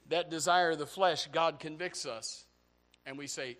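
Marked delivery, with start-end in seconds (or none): none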